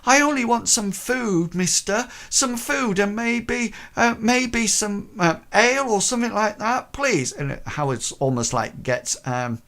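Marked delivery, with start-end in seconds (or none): 0.0-7.3 put-on high timid boy's voice; pleading boy. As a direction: ask for food in a high nervous voice.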